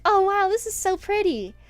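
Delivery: high, bright mock gushing; imitating her own over-the-top admiring; gushing over item. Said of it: "I always make that mistake where I'm like".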